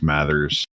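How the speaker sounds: short-a "Mathers", r clearly pronounced. Shown as a demonstration. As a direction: say "Mathers" with a sounded r before the s. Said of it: "there's an R in it".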